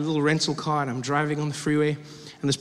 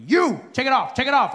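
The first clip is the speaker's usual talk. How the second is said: higher, mock security shout; shouted order; 6 semitones higher, 3 dB louder than usual. Security officer shouting to passenger, brusque. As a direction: shout it at the traveller. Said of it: "They will shout to you".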